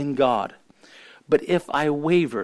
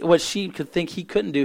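ordinary delivery

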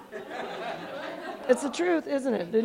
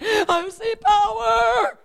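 high, very slow retold scream; shaking scream; 9 semitones higher, much slower than usual. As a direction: stretch it into a shaken cry. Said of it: "I started shaking, and I went".

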